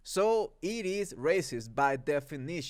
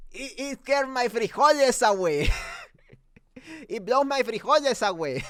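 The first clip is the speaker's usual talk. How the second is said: higher, laughing through a silly phrase; laughing at his own line; 7 semitones higher, 3 dB louder than usual. Real, not acted. Amused at his own nachos-to-frijoles joke, laughing. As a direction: crack yourself up on the joke.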